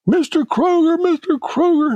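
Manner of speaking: high-pitched teary call down the aisle; crying young girl calling. Retold or imitated. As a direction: mimic a young girl crying out his name. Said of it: "this young girl coming down the aisle crying".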